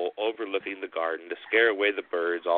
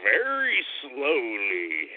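slow creepy scarecrow voice; 4 semitones higher, much slower than usual. Acted. Brother pretending to be Harold, slow creepy reply. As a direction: drag it out eerily slow. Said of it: "would answer in a creepy voice".

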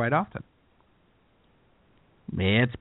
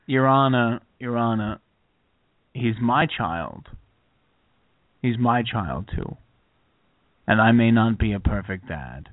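lower-pitched impression of a courtroom plea; Dustin Hoffman; 4 semitones lower than usual. Father pleading to a judge for his child, in a Hoffman impression. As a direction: plead low and haltingly, with pauses between phrases. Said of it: "That's a good Hoffman".

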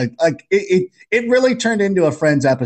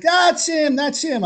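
excited recognition; 13 semitones higher, slower than usual. Retold or imitated. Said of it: "He's like".

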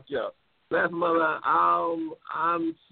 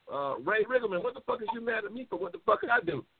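normal talking voice

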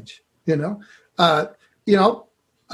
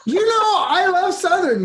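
talking through laughter, much higher; amused and laughing as he answers; real laughter in the voice. Real, not acted. Laughs over Southern accent remark.

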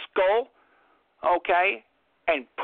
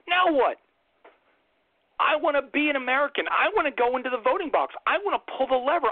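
higher, whiny voice of a torn voter; fed-up undecided voter; 5 semitones higher than usual. Retold or imitated. Mocking the undecided middle group's lament, high and whiny. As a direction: play the lost voter in the middle, pleading to have a candidate. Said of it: "Then you have a group in the middle".

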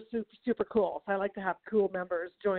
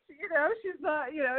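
ordinary delivery

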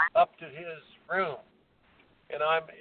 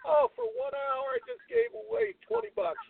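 excited lament; 11 semitones higher than usual. Real, not acted. Lamenting the lost beer money.

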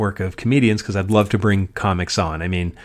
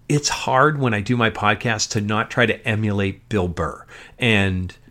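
normal talking voice

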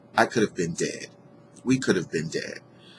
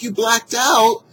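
incredulous; 13 semitones higher, 5 dB louder, slower than usual. Retold or imitated. Reacting to driver's excuse.

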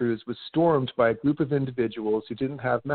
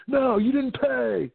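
slow, higher, drawn-out no; insistent clerk; 11 semitones higher, much slower than usual. Retold or imitated. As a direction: stretch the no like a stubborn clerk. Clerk insisting in acted quarrel.

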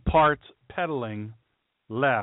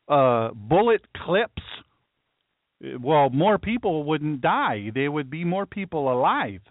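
higher-pitched, halting put-on voice; mock gun-control advocate; 4 semitones higher than usual. Host mocking someone arguing about bullet clips, put-on higher voice. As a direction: play a hesitant objector, pitched up at first. Voices the opposing side's clip argument.

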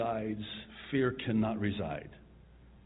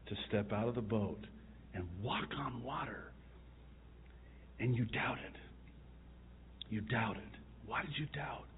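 tender paraphrase; 7 dB quieter than usual. Acted. Jesus to Peter who doubted, soft sorrowful chiding. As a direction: let the disappointment sink in quietly.

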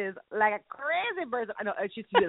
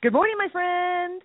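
high, slow sing-song good morning; drawn-out greeting; 8 semitones higher, slower than usual. Real, not acted. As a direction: stretch the greeting out brightly. Cheery show greeting.

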